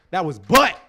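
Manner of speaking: ordinary delivery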